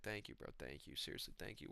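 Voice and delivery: very soft, low thank-you; Dariush. Retold or imitated. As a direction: murmur it almost voicelessly. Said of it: "And Dariush is like".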